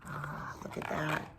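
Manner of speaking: whispered wonder; hushed aside. Real, not acted. Reader hushing the children over the picture. Admiring the beetle on grass.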